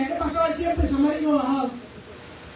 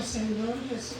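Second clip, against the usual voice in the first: low, hushed plea under the breath; a whispered sigh to the Lord, much softer; breathed-out plea for help, low and soft; desperate murmured prayer; 5 semitones lower, 10 dB quieter than usual. Retold or imitated. Desperate prayer while waiting for result.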